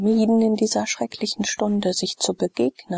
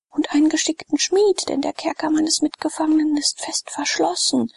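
put-on high, pressing voice of a captive girl begging for help; urgent pleading letter; 9 semitones higher than usual. Nydia's letter read aloud, begging Sallust to save Glaukus, high and urgent. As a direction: plead as if every second counts.

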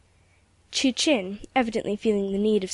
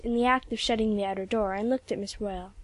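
plain reading voice

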